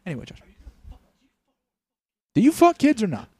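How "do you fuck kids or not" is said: sudden high, loud demand; blurted mock yell. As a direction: blurt it out like a cop losing patience. Mock cop blurts at suspect.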